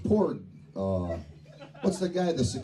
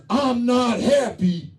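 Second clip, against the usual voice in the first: high, loud, slow grumpy complaint; gruff little man; 8 semitones higher, 5 dB louder, slower than usual. Retold or imitated. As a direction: whine it out like a sulky little man. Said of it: "And he says".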